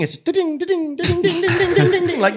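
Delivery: high-pitched imitated text alert dings; ding-ding like a phone going off nonstop; rapid high mimicked notification chimes; phone ping noises.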